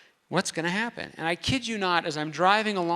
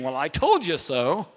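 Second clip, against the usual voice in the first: slow, louder, smug gloat; smug I told you so; 2 semitones lower, 5 dB louder than usual. Retold or imitated. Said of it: "I pitch my way without saying".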